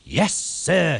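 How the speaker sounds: high, eager yes sir; proud little engine answering his boss. A narrator's reading. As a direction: beam with pride as you say it. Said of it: "Thomas beamed with pride".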